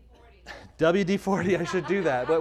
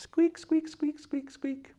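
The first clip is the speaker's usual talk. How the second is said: doorknob squeak imitation; slower than usual. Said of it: "our doorknob squeaking".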